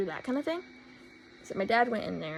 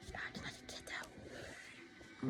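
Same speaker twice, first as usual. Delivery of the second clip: a quick whispered dig under the breath; whispered aside; 16 dB quieter, slower than usual. Real, not acted. Whispering a dig at the dog's owner behind her back. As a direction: drop to a whisper for the jab.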